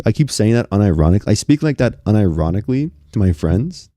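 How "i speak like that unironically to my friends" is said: ordinary talk, nothing marked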